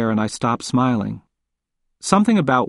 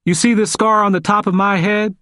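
plain reading voice

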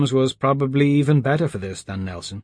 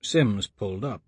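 plain reading voice

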